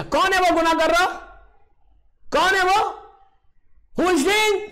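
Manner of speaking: high, loud calling out to the crowd; urgent demanding question. Acted. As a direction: call out who did it. Moses asks the crowd.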